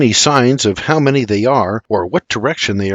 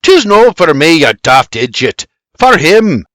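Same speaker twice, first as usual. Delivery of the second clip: loud, raised exasperated comeback; irritated retort; 4 semitones higher, 6 dB louder than usual. A narrator's reading. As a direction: snap back at a fool. Doc snaps back an insult.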